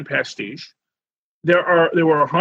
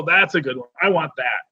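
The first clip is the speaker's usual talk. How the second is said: ordinary delivery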